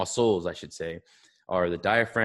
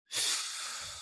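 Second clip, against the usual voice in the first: forceful breathy exhale; blowing out hard; 10 dB quieter than usual. Real, not acted. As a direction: blow all the air out hard. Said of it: "blow as hard as you can".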